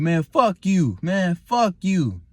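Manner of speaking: hammed-up angry outburst; loud cussing. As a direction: imitate the supervisor cussing out loud. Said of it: "All loud and shit".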